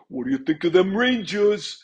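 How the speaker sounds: lightly voiced thick New York accent. Retelling a hockey-mad Rangers fan, New York accent. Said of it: "thick New York accent".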